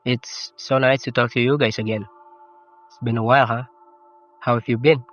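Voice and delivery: usual speaking voice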